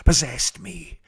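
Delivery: usual speaking voice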